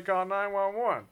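high, very slow, deadpan newsman voice; Josh Mankiewicz impression. Quoting Bill Hader's Mankiewicz bit to his co-host, slow and high. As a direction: drag it out like a TV crime reporter. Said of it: "It's Bill Hader's impression of Josh Mankiewicz".

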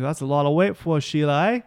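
mock Aussie accent with rising end; Mark Wahlberg, badly Australian. Playing Wahlberg chatting up the woman, mock Australian accent. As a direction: tease in a stock Australian accent. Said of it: "Now he's Australian?".

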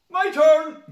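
imitating an excited kid yelling, very high and loud; shrieked mock-child demand; child's eager shout. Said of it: "and Ellie just goes".

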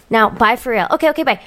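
normal talking voice

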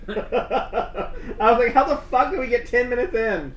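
laughing through disbelief; shocked laugh while asking. Real, not acted. Shocked at recording time.

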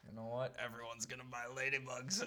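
low, soft scheming businessman; mock deep boss. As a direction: say it like a sly executive pitching.

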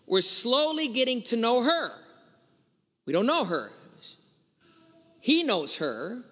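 ordinary talk, nothing marked

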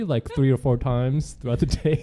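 normal talking voice

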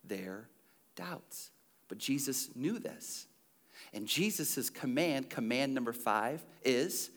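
usual speaking voice

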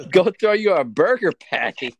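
hammed-up imitation of faux American; mimicking bad American accent. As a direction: laughingly parrot a fake American accent.

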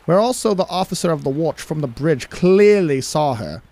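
amateur British accent reading. Shown as a demonstration. Co-host reading a captain's log on request, attempted British accent. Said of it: "try to do a British accent".

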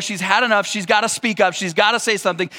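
usual speaking voice

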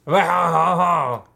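hammy high French chef voice. Pretend. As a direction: camp it up like a cartoon French chef. Hammed-up French parody with laughs.

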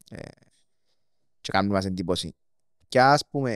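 ordinary talk

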